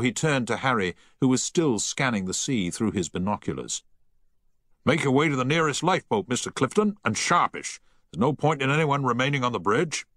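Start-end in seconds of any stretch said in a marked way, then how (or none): 4.8-10.1 higher, raised captain giving the order; firm naval command. A narrator's reading. Captain orders the crew to the lifeboats, commanding.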